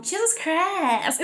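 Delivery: high, very slow, drawn-out "Jesus Christ"; imitating a cartoon character's catchphrase; Mister Slave's Jesus Christ. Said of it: "is the way he says it".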